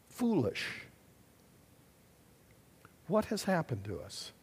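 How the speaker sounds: ordinary talk, nothing marked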